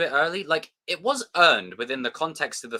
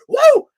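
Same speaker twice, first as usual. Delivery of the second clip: very high, loud whoop; imitated falsetto holler; Silver's whoop; 14 dB louder than usual. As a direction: let out a piercing "woo".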